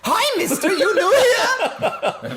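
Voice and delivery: put-on very high, slow little girl. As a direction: play the small girl, drawn out. Voicing the girl character.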